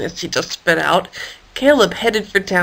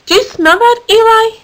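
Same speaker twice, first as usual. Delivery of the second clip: much higher, eager question; curious, excited asking his pal; excited sniffing; 13 semitones higher, 5 dB louder than usual. Acted. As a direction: perk up at a smell.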